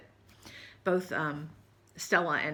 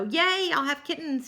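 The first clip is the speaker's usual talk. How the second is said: excited; 7 semitones higher, 5 dB louder, slower than usual. Real, not acted. Happy kitten pregnancy news.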